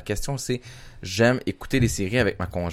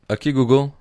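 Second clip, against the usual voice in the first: louder, higher wake call to the assistant; calling out to the phone; 4 semitones higher, 5 dB louder than usual. Real, not acted. Host calls out to Google on the phone, loud and higher. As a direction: raise the voice so the phone hears.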